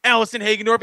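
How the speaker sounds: big, projected podcast guest intro; hosting a live show intro; show announcer. Acted. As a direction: announce the guest with building energy.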